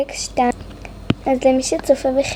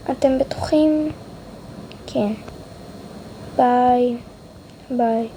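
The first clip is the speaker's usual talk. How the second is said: ordinary delivery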